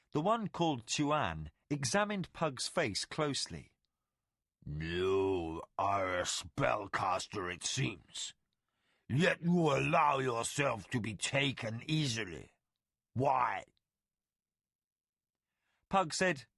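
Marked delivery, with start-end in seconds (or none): 4.7-13.7 slow, lower creature voice; suspicious tiger creature. Acted. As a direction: press him slowly, full of suspicion. Chuan questions Pug.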